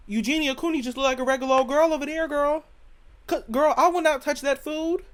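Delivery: loud, high-pitched disbelief; incredulous rant. Real, not acted. Comic refusing British food, loudly incredulous. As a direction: raise your voice in comic outrage.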